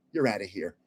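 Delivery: a low, quiet verdict from the guard; stern guard. Retold or imitated. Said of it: "And he goes".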